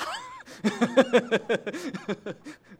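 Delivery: bright, high burst of laughter; a real high laugh after a joke. Real, not acted. Laughs at his own joke.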